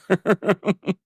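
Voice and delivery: high rippling chuckle; laugh. Real, not acted. Recapper amused by her own liar line, laughing. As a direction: laugh in a quick run of high pulses.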